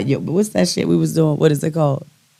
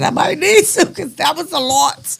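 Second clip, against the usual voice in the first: high, strained self-quote; replying to her daughter in a high mock voice; own retort; 13 semitones higher than usual. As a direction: squeak it out defensively. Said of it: "I said".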